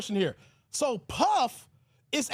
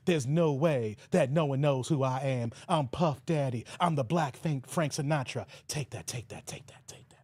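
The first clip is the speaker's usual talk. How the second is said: [name] impression; 9 semitones lower, 3 dB quieter than usual. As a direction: drop low and boast like Puff. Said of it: "Puff over here thinking".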